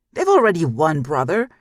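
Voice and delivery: loud exasperated outburst; shouting at brothers in frustration; frustrated shout. Acted. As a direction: throw the words at them.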